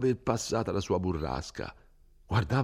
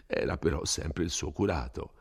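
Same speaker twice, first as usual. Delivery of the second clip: ordinary delivery, nothing marked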